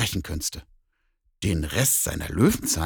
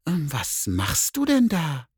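high, slow, curious cat voice; a nosy cat sniffing around; 7 semitones higher, slower than usual. Acted. Cat peeks into the bear's basket, high and curious. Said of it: "fragte dann".

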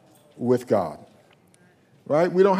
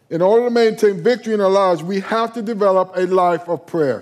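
fast, louder, higher preaching; full-force preaching; 3 semitones higher, 3 dB louder than usual. Real, not acted. Pastor exhorting his church at full force. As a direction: drive the point to the congregation.

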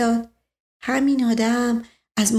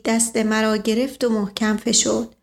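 ordinary delivery

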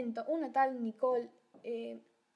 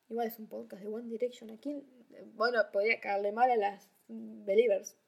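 normal talking voice

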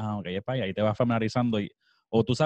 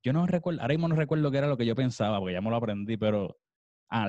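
ordinary talk

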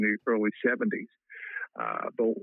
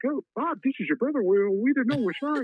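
mock flustered police; 12 semitones higher than usual. Police officers apologise, flustered.

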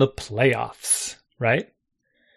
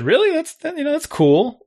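surprised reply; 12 semitones higher than usual. Retold or imitated. His surprised reply retold.

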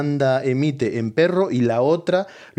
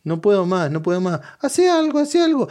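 dog voice; 8 semitones higher than usual. Retold or imitated. Wife voicing the dog's suffering, high squeaky plea. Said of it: "ella le pone la voz a la".

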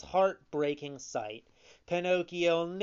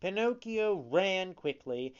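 ordinary delivery, nothing marked

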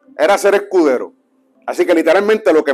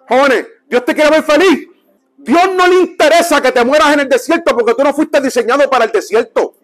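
high, impassioned preaching; urgent pulpit proclamation; forceful exhortation; 6 semitones higher than usual. Real, not acted. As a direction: raise your voice to rally the congregation. Preacher exhorts the young at full force.

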